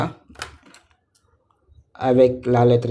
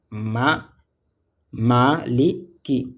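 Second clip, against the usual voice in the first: slow syllables; much slower than usual. Shown as a demonstration.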